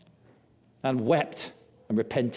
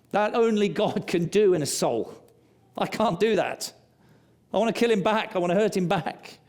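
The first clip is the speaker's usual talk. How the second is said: impassioned preaching; 7 semitones higher than usual. Real, not acted. Preacher's passionate confession.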